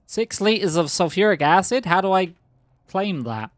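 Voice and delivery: baffled at what just dropped; surprised. Real, not acted. Surprised at the game loot.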